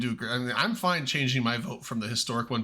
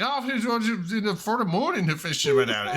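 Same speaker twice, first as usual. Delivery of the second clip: put-on thick Irish accent; 7 semitones higher, much slower than usual. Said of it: "he was like".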